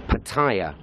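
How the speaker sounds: PATTAYA as puh-TIRE, short first syllable; pronunciation three. Shown as a demonstration. Vlogger showing a popular way, Pattaya as puh-TIRE. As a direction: say Pattaya like puh plus car tyre. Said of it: "pronunciation number three".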